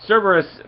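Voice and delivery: Cerberus said high and loud; stressed name. Shown as a demonstration. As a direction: punch the word Cerberus as you explain it. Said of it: "At least Cerberus stands for something".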